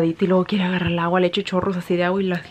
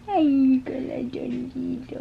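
tender coo; 5 semitones higher, much slower than usual. Real, not acted.

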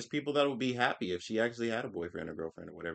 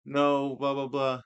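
mock crying; 5 semitones higher, 4 dB louder, slower than usual. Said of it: "Somebody would cry and be like".

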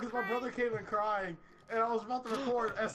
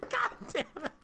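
mild mock yell; imitated outburst; 8 semitones higher than usual. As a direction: mimic your outburst at normal volume. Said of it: "I said".

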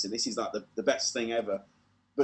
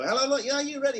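high, loud crowd-hyping call; hyped warm-up call; 11 semitones higher, 4 dB louder, faster than usual. Retold or imitated. Warm-up man revving up the crowd, high and loud. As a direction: pump up a waiting studio audience.

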